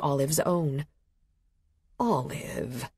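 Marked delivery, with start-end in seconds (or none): 2.0-2.9 lower, softer, disappointed; mother letting her daughter hear her disappointment. A narrator's reading. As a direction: let the disappointment sink in. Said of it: "a note of disappointment in her voice".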